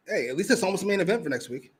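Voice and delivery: normal talking voice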